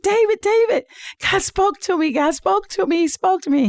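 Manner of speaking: high-pitched, urgent acted wake-up call; excited retelling. Acts herself waking husband, excited.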